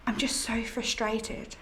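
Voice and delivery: whispered, worn-out admission. Acted. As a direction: breathe it out in a whisper. Whispered confession after apology.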